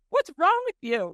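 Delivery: high, loud mock outrage; hammed-up cry of disbelief; retold outraged yell. Waxing client retells her friend yelling at her, mock outrage. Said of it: "She's like".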